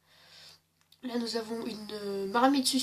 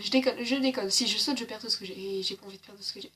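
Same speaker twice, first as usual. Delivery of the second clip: usual speaking voice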